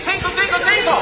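high, light tinkling like raindrops; raindrop tinkle. Shown as a demonstration. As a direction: rain sound effect. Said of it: "Say it".

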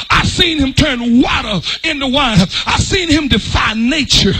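rising, shouted preacher's cadence; fiery pulpit preaching. Acted. Preaching at full force.

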